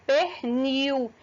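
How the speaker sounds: "pernil" slowly, the L turned into U; final L as U. Shown as a demonstration. Teacher demonstrating two vowel sounds, slow final L as U. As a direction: stretch "pernil" so it ends in a clear U. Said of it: "ele tem som de U".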